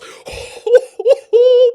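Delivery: high, loud shrieking laugh; excited shriek laugh. Real, not acted. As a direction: scream with delight at the chance. Goal chance, wild reaction.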